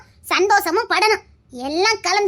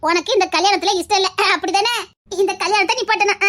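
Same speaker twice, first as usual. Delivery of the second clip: normal talking voice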